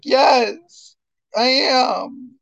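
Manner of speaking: high, loud proud shout; emphatic yes. Retold or imitated. Answering the friend from Olean, a loud proud yes. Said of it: "And I was like".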